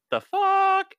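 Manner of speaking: high mock exclamation; shocked disbelief. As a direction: pitch leaping up in comic shock. Elevator riders' shocked reaction acted out.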